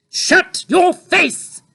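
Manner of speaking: very high, slow, loud angry snap; snapping. A narrator's reading. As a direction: snap at the child. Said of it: "snapped Mother".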